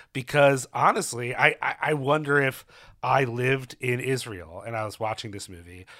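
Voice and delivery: usual speaking voice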